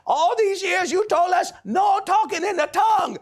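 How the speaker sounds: high, loud acted wife; shouted scolding. Wife accusing husband of changing his teaching, shouting. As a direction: scold him at the top of your pitch.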